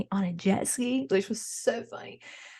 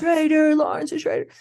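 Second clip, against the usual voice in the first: mock accusation; 7 semitones higher, 7 dB louder than usual.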